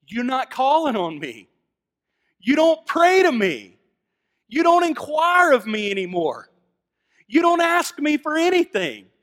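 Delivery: loud, high preaching as God rebuking Judah; preaching at full force. Acted. Preacher voicing God to a complacent church, loud and high. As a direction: thunder God's complaint at the congregation.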